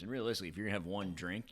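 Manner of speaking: usual speaking voice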